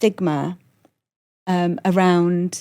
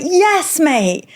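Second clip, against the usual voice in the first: excited urging; 8 semitones higher, slower than usual. Retold or imitated. Urging [name] to admit it.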